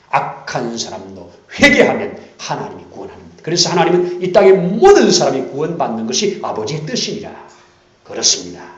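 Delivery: loud, driving contrast; preaching at full force. Acted. Preacher proclaiming God's will to the congregation. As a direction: hammer the contrast home.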